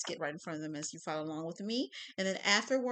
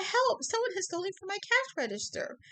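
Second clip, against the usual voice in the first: retold scream; 12 semitones higher, 5 dB louder than usual. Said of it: "He screamed out".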